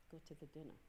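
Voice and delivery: barely above a breath, firm; hushed inner voice. Retold or imitated. God answering John, hushed and low.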